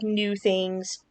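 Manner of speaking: ordinary talk, nothing marked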